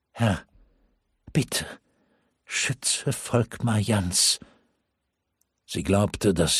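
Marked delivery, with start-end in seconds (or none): 0.1-4.4 quick prayer. Acted. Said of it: "schickte ein Stoßgebet zum Himmel".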